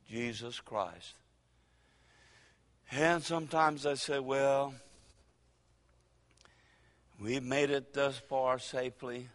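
usual speaking voice

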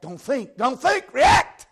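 rising from firm to screamed; building shout. Real, not acted. Preacher building to climax.

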